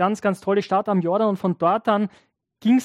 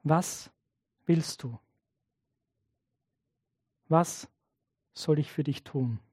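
softly asked, much softer; gentle question; 4 semitones lower, 8 dB quieter than usual. Acted. Jesus gently asking the blind man, soft and tender. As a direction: ask tenderly, almost hushed.

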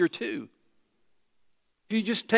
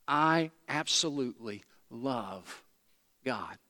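sincere devotion; 5 semitones lower, 3 dB quieter than usual. Acted. Voicing a fully devoted believer.

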